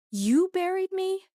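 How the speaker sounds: YOU buried me, thrown up high in shock; stunned disbelief, voice cracking upward; disbelieving cry. A narrator's reading. Repeated in disbelief.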